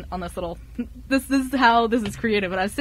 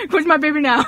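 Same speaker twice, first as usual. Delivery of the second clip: playful laughing claim; 5 semitones higher, 6 dB louder than usual. Retold or imitated. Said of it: "I'm like".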